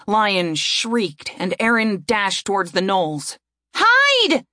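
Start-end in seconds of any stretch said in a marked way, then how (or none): 3.8-4.5 high, loud shout. A narrator's reading. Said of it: "Erin shouted at Lion".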